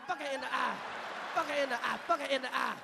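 ordinary talk

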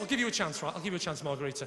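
ordinary talk, nothing marked

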